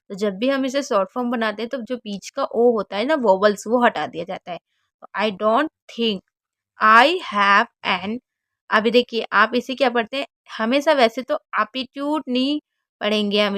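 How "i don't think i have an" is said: slow reading. Shown as a demonstration. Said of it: "reading dialogue line".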